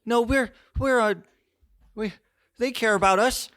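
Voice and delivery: very high, loud protesting voice; high-pitched, stammering objection; Pink. Acted. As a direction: insist in a squeaky high pitch. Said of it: "You see Pink go up".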